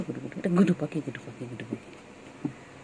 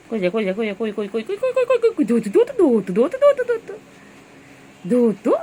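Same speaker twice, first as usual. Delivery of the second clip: loud, much higher, squealing game calls; playful shouting while chasing; excited chase; 6 semitones higher, 9 dB louder than usual. Real, not acted. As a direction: hype up the chase, rising to shrieks.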